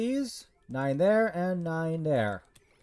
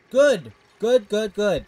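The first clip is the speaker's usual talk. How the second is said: excited; 3 semitones higher, 7 dB louder, slower than usual. Real, not acted. Cheering on game progress.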